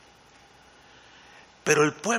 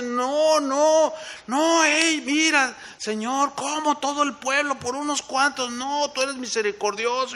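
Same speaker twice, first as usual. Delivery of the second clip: much higher, urgent begging; pleading Moses; 9 semitones higher than usual. Retold or imitated. Moses begging the Lord for mercy, high and urgent.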